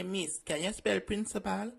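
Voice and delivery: usual speaking voice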